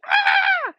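loud, very high scream; retold inner scream. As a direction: scream out the hidden panic. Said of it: "Inside, I'm like".

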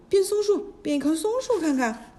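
high, loud, fast crowd yelling; clamouring shout. A narrator's reading. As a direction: shout it like rowdy students egging him on. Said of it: "众弟子乱嚷着".

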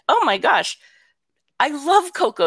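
high-pitched delighted enthusiasm; gushing, retold. Grandma thrilled at watching Coco with granddaughter, gushing.

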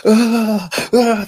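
high, loud mock tantrum cry; acted tantrum yell. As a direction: let out a hammed-up tantrum wail. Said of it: "And this guy's just like".

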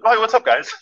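high, cheery and oblivious hello; breezy greeting. Retold or imitated. He strolls up to the panicked team, high breezy nonchalance.

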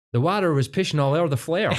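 high put-on Canadian-Scottish twang; Canadian-Scottish hybrid. Doing a comedy sketch's returning emigrant, hammed-up Canadian-Scottish accent. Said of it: "pure weird Canadian-Scottish hybrid".

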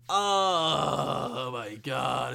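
long drawn-out groan of relief; blissful relief. Real, not acted. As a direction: stretch it out in blissful anticipation. Relishing the coming Seattle trip.

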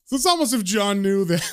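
usual speaking voice

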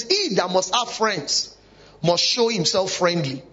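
slow, each word of "He that must have friends" drawn out; slow, deliberate scripture read; slow emphatic quote. Shown as a demonstration. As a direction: read the verse slowly so it sinks in. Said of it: "it says".

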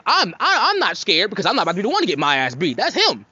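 ordinary talk

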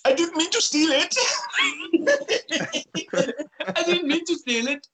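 very high, hammed-up little boy's whimper; mock a sorry child in a squeaky voice; high-pitched pleading kid impression; sad little boy. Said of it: "Remember the sad little boy from Bloodsport?".

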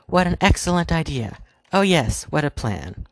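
high, theatrical fake enthusiasm; hammed-up praise. A narrator's reading. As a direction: ham it up like bad stage acting. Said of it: "the Dark Queen cried out".

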